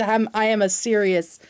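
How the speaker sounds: usual speaking voice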